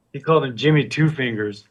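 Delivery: normal talking voice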